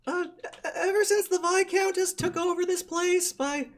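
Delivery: a tiny kobold whining at the stench; squeaky kobold. Acted. Kobold continues speaking.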